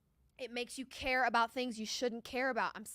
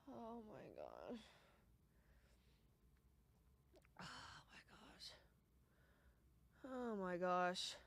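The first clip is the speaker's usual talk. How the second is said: slow, breathy dismayed murmurs; hushed dismay; 14 dB quieter, slower than usual. Real, not acted. Bettor murmuring in disbelief at the TV, hushed and slow.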